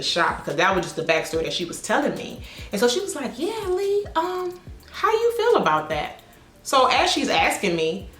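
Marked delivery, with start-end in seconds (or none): 3.4-6.2 high, sing-song mock client voice; imitating client. Client in the chair asking Lee, high mock voice. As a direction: put on the client's high innocent tone.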